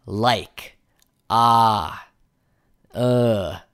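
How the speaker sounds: hesitant drawn-out filler sounds. Shown as a demonstration. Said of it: "fillers are things like um".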